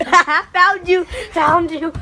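loud triumphant yell; excited shouting. Real, not acted. Found hider.

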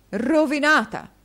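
high, loud, hammed-up despair; a husband staging theatrical despair; melodramatic exclaim. A narrator's reading. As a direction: play the ruined man to the hilt. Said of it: "esclamò il signor Mantalini".

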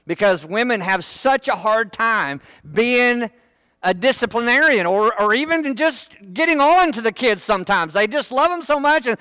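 ordinary talk, nothing marked

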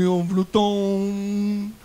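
slow, dragged stutter. Acted. Văsălica stutters back to the boss, slowly. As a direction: drag each syllable out painfully.

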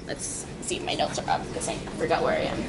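very quiet, mostly unvoiced murmur to yourself; hushed aside while hunting through notes; talking to oneself. Real, not acted. Speaker checks notes after losing their place, near-whispered.

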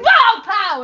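sudden high, loud "pow" as the cat strikes; mock attack sound effect. Telling friends about a cat's swipe, mock cartoon pow.

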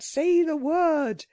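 high, slow creature command; urgent cry. A narrator's reading. Creature tells the girl to work the amulet, crying out. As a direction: draw out the order, pitched high. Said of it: "cried the Psammead".

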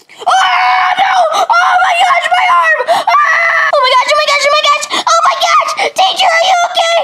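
high yelping pain; acted pain and panic. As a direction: shriek over a hurt arm. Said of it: "I acted".

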